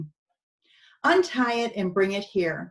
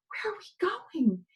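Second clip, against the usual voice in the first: high, breathless whisper of a scared donkey; frightened whisper; 8 semitones higher, 4 dB quieter than usual. Acted. Frightened donkey whispering to the men. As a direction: whisper it like a frightened little animal.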